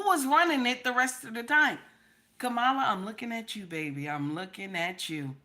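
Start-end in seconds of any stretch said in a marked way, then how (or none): 2.4-5.4 low knowing callout. Real, not acted. Calling out Kamala.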